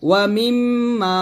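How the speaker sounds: "মিম" hummed long through the nose before "মা"; held ghunna. Shown as a demonstration. Teacher modelling for learners, nasal hold on "মিম". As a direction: hold the m of "মিম", then stretch "মা". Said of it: "সেখানে অবশ্যই গুণ করবেন".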